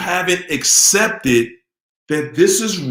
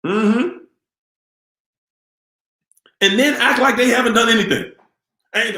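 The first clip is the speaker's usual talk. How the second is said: usual speaking voice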